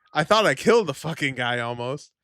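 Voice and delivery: normal talking voice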